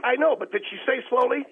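high, loud, badgering demand; scolding yell. Real, not acted. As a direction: yell it like a furious taskmaster. Scolding the host, demanding compliance.

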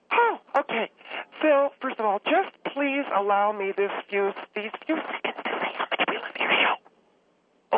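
tearfully pulling herself together, then voice giving out; choked up. Acted. Caller begging a few seconds to praise the host's show, choked up. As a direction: gush through tears until the voice fails. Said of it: "I'm getting so choked up".

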